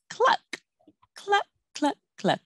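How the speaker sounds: high clucking; hen cluck. Shown as a demonstration. Teacher showing the hen sound to children, high cluck.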